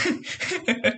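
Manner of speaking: breaks into a high laugh on the word. Real, not acted. Teasing his friend, laughing as he speaks. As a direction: laugh it out, amused.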